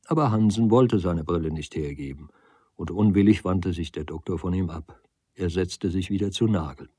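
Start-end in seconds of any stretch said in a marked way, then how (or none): none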